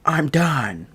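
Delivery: high-pitched, exasperated mock huff; fed-up teacher. Teacher to laughing class, exasperated and giving up. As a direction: imitate a teacher who has had enough. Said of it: "which she didn't like".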